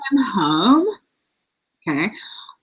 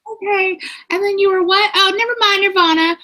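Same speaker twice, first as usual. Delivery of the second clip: high mock impression of an impatient friend; 10 semitones higher than usual. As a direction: parrot her interrupting, hammed up. Mocking friend's interruptions.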